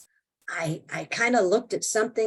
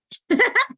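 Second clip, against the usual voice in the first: bright rising giggle; a quick high laugh at herself; short high laugh; 4 dB louder than usual. Real, not acted. Laughing at her own tantrum.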